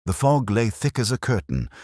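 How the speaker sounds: ordinary delivery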